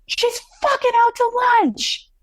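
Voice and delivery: high, drawn-out imitation of a scornful mother; scornful retelling. Mother to the woman beside her, retold scornful jeer. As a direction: mimic her sneer, high and slow.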